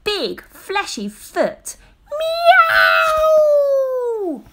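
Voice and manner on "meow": high, loud crying meow; loud wailing meow; pained cat yowl. A narrator's reading. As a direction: yowl as the cat gets stepped on. Cat trodden on by baby.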